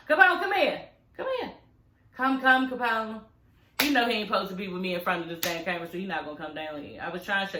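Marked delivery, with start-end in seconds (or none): 0.1-0.8 calling the dog. Real, not acted. Owner calling her dog Capone over, raised call. 1.2-3.2 coaxing the dog. Real, not acted. Calling the dog over.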